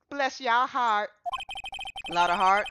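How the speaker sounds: Southern drawl; a sweet Southern belle's bless your heart; Southern accent. Shown as a demonstration. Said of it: "they said a southern accent".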